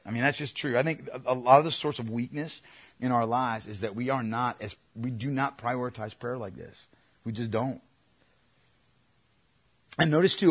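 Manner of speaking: ordinary talk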